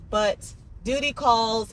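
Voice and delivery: normal talking voice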